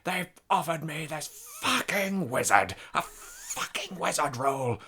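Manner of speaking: hammed-up furious thespian; angry Peter O'Toole impression. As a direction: rant like an offended grand old actor. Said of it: "and he goes".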